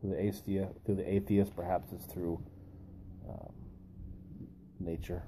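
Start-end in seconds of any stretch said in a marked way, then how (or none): none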